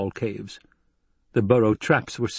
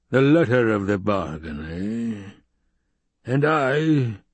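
the Baron; slower than usual. A narrator's reading. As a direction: savour it like a sly tyrant. Baron voiced in audiobook.

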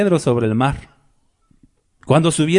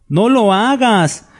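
higher, drawn-out prohibition; emphatic admonition; 6 semitones higher, much slower than usual. Acted. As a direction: stretch it out slowly with raised pitch. Angel repeats his prohibition.

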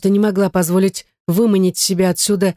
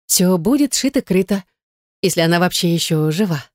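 higher, coaxing female character; scheming heroine; 4 semitones higher than usual. Acted. Gayne talking David into leaving the wife, coaxing. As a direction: coax him lightly into the plan.

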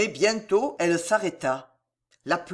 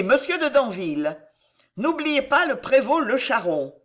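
higher, emphatic royal orders; raised, authoritative queen's voice; commanding queen; 5 semitones higher, 4 dB louder than usual. Acted. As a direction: hand out orders as a queen to her men.

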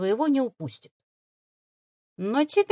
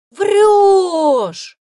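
very loud, high, drawn-out jeer; mocking reproach; 11 semitones higher, 13 dB louder than usual. Boy doubts the girl's talking fox, mocking. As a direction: call her a liar with a grin. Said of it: "укоризненно насмешливо протянул паренёк".

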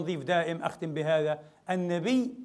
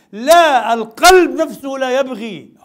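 loud, slow insistence on القلب; slow it down and thunder each word; emphatic loud correction; 6 semitones higher, 13 dB louder, much slower than usual. Real, not acted. Preacher correcting the congregation, loud and slow.

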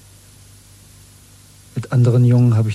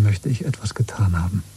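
whisper. Acted. Verschwörerisches Geständnis.

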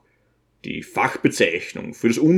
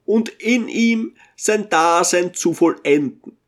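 put-on higher, solemn preacher-like old man; pious old Bisonski; 7 semitones higher than usual. Old Bisonski praising the Lord's peace, solemn and raised.

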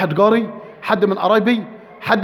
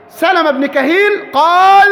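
booming slow announcement; 5 semitones higher, 4 dB louder, slower than usual. Real, not acted. Preacher announcing an early authority.

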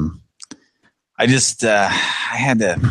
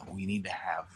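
whispering; 3 semitones higher, 14 dB quieter than usual. Real, not acted. Aside during a live call.

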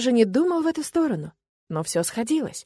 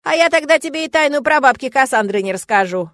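offhand; 6 semitones higher, 6 dB louder than usual. Acted. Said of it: "и невзначай так добавил".

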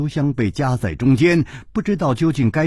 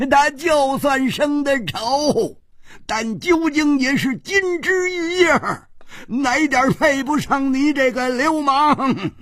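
狂吼; 12 semitones higher than usual. Acted. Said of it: "他狂吼道". (高声狂吼，带着哭腔)

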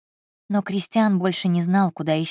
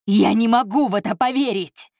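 louder outburst of disbelief; shouting; 4 semitones higher, 5 dB louder than usual. A narrator's reading. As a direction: yell it at the ceiling. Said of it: "снова закричал Кристиан".

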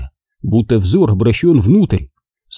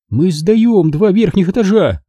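higher, quick excuse; defensive Margo; 8 semitones higher than usual. A narrator's reading. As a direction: justify herself hastily.

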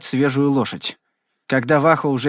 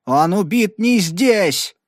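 slow, high, forceful protest; accused cowboy denying the charge, furious; furious outcry; 6 semitones higher, much slower than usual. A narrator's reading. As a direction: hurl the denial out. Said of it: "яростно выкрикнул Хорн".

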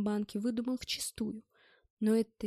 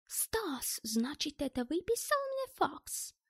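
high, plaintive foreigner's question; 5 semitones higher, slower than usual. A narrator's reading. German asks Stas about the fax, plaintive voice. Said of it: "он жалобным голосом произнёс".

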